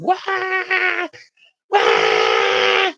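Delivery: imitating a sunburned kid's wail; retold scream. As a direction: mimic a kid's startled scream of pain. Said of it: "and screamed".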